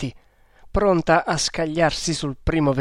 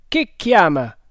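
calling out; 4 semitones higher, 7 dB louder than usual. A narrator's reading. Shouting across the river.

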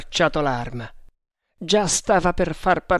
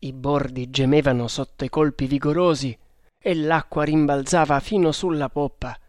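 usual speaking voice

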